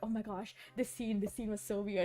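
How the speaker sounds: soft, re-enacted aside to a sister; hushed cinema commentary. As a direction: comment under your voice during the film. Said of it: "I'll be really soft or just whisper".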